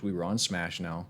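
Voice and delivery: ordinary talk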